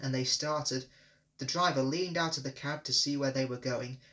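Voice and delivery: usual speaking voice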